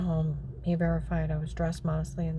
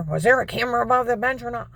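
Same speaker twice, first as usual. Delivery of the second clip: loud, higher interrogating yell; shouting; 6 semitones higher, 8 dB louder than usual. Retold or imitated. Agent grilling the speaker, loud yell. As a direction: bark the question at her.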